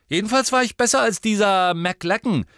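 high, loud, gloating boast; smug satisfaction. A narrator's reading. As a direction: crow it with pride. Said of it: "sagte Ron voller Genugtuung".

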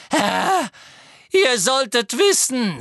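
high, loud, wailed plea; desperate pleading. A narrator's reading. Servant tries to explain herself to her master, desperate wail. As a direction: plead frantically with your master.

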